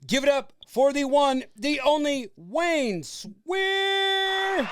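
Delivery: booming ringmaster intro, rising high and loud. Acted. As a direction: announce him like a circus ringmaster. Host introducing guest like announcer.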